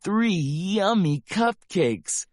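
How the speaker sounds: ordinary delivery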